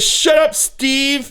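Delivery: dismissive. Retold or imitated. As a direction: shut him down with scorn. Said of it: "He says".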